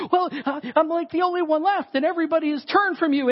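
high-pitched, pleading complaint; desperate Elijah. Acted. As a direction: blurt it out in acted desperation. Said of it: "he's just in this place of desperation".

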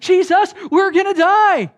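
high, very loud cry of alarm; panicked shout. Acted. Disciples waking Jesus in terror, acted panicked shout. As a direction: yell it out as if about to drown.

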